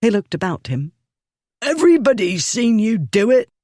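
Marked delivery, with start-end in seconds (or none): 1.6-3.5 put-on indignant drunk. A narrator's reading. Bully appeals to onlookers.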